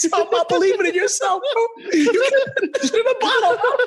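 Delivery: high, laughing through the words. Real, not acted. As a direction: crack up while repeating him. Friends laughing at answer.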